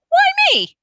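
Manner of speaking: indignant Hermione. A narrator's reading.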